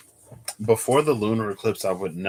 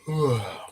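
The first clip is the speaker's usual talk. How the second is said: a long open yawn; tired sighing yawn; 3 semitones higher than usual. Real, not acted. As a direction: let a real yawn out. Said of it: "there's the yawn".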